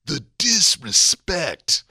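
very slow mock outrage; lightly put-on wrestler indignation; Undertaker. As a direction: draw out each syllable of DISRESPECT. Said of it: "I always channel my inner Undertaker".

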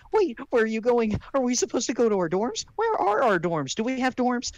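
put-on high, loud, panicky girl voice; lost and flustered, rapid questions; flustered Ruby.

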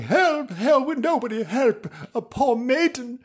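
high, loud maiden's plea; damsel in distress. Acted. Lost princess calls into the dark, high plea. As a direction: wail like a helpless maiden.

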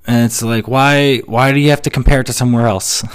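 ordinary talk

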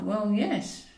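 usual speaking voice

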